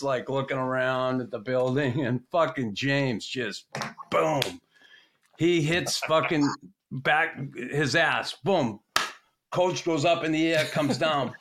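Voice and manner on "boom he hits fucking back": ordinary talk, nothing marked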